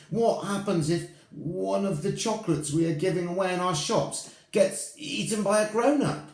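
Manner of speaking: high, fast witch question; squeaky witch asking eagerly. Acted. Witch in audience asks.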